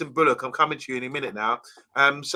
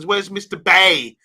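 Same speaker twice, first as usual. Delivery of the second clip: put-on loud, slow Birmingham accent, raised pitch; higher, drawn-out Brummie question; 6 semitones higher, 8 dB louder, slower than usual. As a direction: put on Brummie, saying B as "Bay". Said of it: "He's in the Birmingham Brunning accent".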